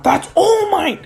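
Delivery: very high, loud fanboy cry; starstruck fan. Acted. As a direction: squeal at seeing your idol. Fan spots his idol.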